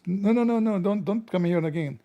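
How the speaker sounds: high-pitched no-no-no dismissal; mock outraged refusal; high protest. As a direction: wave him off in a rising panic. Retold dismissal of admin.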